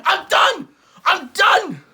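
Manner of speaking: loud, high exasperated rant; mock-furious rant about dance challenges; frustrated rant. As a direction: blow up like you can't take it anymore. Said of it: "I'm getting beyond frustrated".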